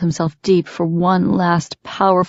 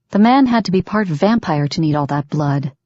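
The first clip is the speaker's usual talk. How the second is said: plain reading voice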